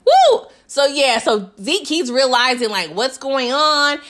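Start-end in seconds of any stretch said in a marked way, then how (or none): 0.1-0.5 high, loud "woo"; excited whoop. Real, not acted. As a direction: let out a high whoop at the drama. Reacting to the drama.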